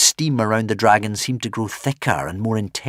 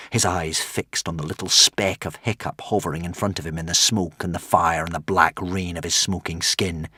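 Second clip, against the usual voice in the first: ordinary delivery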